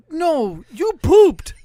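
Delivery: high-pitched mock daughter voice; accusing daughter. Daughter accuses dad after his prank, indignant. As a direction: imitate a child's loud, indignant accusation.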